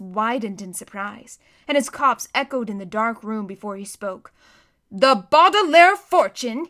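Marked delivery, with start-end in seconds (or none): 4.9-6.7 slow, much louder stern warning. A narrator's reading. Banker refusing Count Olaf the fortune, slow and stern. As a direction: lay down the law, slowly and firmly. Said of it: "he said sternly".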